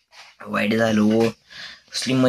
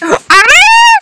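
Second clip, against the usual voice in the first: surprised shout; 4 dB louder, much slower than usual. Real, not acted. New member suddenly appears.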